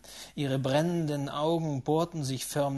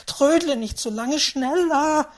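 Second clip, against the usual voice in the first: high, loud urging; frantic old lady; beside herself; 11 semitones higher, 10 dB louder than usual. A narrator's reading. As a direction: hurry him along frantically. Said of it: "Sie war ganz außer sich".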